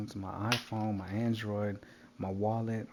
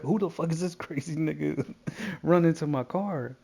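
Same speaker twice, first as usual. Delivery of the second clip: higher, louder mock alarm through laughter; imitating alarmed strangers; 6 semitones higher, 5 dB louder than usual. Mimicking people whose car he ran to, laughing mock alarm. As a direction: laugh through their startled question.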